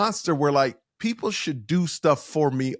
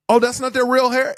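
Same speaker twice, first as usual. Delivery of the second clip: retold surprise, higher and louder; surprised question; 8 semitones higher, 6 dB louder than usual. New hire asking the makeup lady about the wigs, surprised. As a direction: ask it in mild disbelief.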